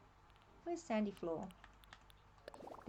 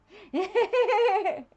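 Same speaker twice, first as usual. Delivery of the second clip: big laugh; 10 semitones higher, 15 dB louder than usual. Real, not acted. Laughs at own fumbling.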